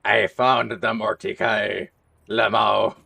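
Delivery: loud, slow Scottish brogue; Scottish accent. Retold or imitated. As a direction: call it out in a hammy Scottish accent. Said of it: "I'm just making him Scottish".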